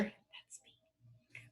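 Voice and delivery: very soft whispered aside. Shown as a demonstration. Teacher owning up to the word teacher, whispered. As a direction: drop to a tiny whisper.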